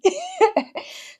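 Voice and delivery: high-pitched burst of laughter. Real, not acted. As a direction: let out a squeaky laugh. Laughs after her own quote.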